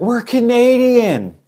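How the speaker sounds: high, drawn-out shout; retold shout. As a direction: call out to the sailors on the ship. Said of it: "we were shouting at them".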